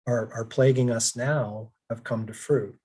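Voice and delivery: ordinary delivery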